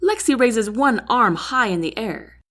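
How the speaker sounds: ordinary delivery, nothing marked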